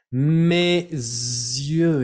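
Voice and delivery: Mes yeux with a Z linking into yeux; French liaison. Shown as a demonstration. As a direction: sound the liaison clearly, mez-yeux. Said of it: "eso les cuesta mucho".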